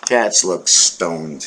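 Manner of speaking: slow, low, deadpan observation. Shown as a demonstration. To viewers about the cat, slow low deadpan. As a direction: drop the voice low and drag it out slowly. Repeats line, then whispers it.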